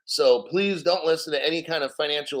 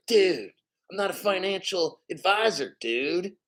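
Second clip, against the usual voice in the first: mock Californian dude accent; California accent; 3 semitones higher than usual. Streamer showing how somebody from California talks, mock Californian accent. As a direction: imitate a laid-back California dude accent. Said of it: "somebody from California".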